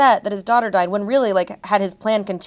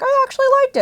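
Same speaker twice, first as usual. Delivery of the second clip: much higher, louder, bright mock gush; mock Sarah; 6 dB louder, much faster than usual. Host teases Sarah's predictable verdict, much higher mock gush. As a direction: squeak it out as a parody of her cohost. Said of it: "Sarah will be like".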